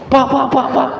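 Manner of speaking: high, urgent repeated call; acted high-pitched hailing; calling after someone. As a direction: imitate a woman calling out in pursuit. Retold woman calling after him.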